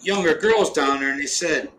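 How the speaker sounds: ordinary talk, nothing marked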